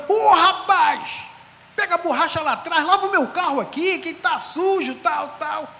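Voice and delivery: exclaim, then rattle off the chores; retold hearty exclamation. Pastor accepting a car wash, high excited instructions.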